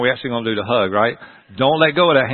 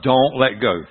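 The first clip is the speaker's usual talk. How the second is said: normal talking voice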